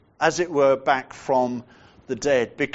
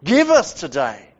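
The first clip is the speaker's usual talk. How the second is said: louder, firm "Give us today" with weight on "Give"; strong demanding; 4 dB louder than usual. Shown as a demonstration. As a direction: ask as though demanding. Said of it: "the sense there is very strong".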